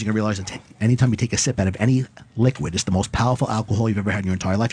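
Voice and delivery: hypnotist suggestion. Acted. Hypnotist gives drunk suggestion.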